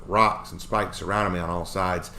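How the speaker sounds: ordinary delivery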